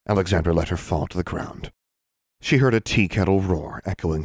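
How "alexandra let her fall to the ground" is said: ordinary delivery, nothing marked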